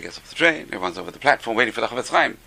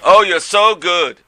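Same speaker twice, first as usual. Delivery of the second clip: slow, drawn-out, louder mock praise; higher-pitched sarcastic compliment; 5 semitones higher, 5 dB louder, much slower than usual. Shown as a demonstration. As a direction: lay the praise on slow and loud. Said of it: "sarcasm can be taken both ways".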